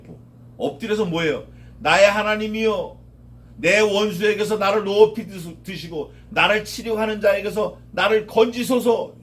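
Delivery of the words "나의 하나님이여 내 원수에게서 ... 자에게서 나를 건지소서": loud, earnest cry of prayer, pitch raised; fervent prayer. Acted. Prostrate believer cries out to God, loud fervent prayer. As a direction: pray out loud with full force.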